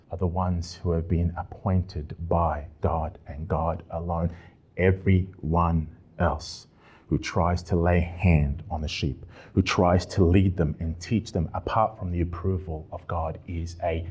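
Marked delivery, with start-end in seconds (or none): none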